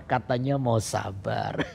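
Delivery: low, slow gentle chiding; God's reminder. Retold or imitated. God reminding the preacher as anger rises, low slow reminder. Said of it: "hati saya tiba-tiba ada suara".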